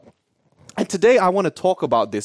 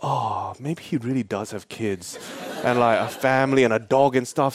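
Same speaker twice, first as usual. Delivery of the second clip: low, soft, mock-remorseful musing; quiet, sheepish second thoughts; guilty; 6 semitones lower, 5 dB quieter than usual. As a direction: think aloud with a pang of guilt. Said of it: "I started to feel really guilty".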